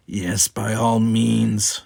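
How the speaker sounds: mocking, drawn-out agreement; sneering. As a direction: drag it out with scorn. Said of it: "sneered Gower".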